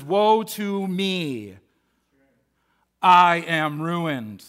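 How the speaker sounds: very slow, drawn-out woe; Isaiah slowly confessing his ruin; slow lament. Acted. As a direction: stretch each word like a broken man. Isaiah's cry, slowed.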